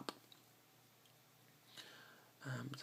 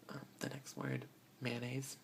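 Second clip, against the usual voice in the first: normal talking voice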